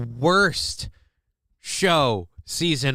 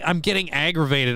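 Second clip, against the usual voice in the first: usual speaking voice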